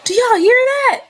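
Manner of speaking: alarmed shout. Real, not acted. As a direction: call out in shock. Alarmed by loud bangs.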